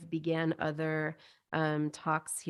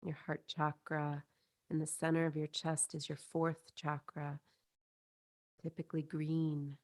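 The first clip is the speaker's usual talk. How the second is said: soothing guided-visualization voice, spacious phrasing; guided meditation; 2 semitones lower, 5 dB quieter than usual. Acted. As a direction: lead them gently inward, softer and unhurried. Leads a chakra meditation.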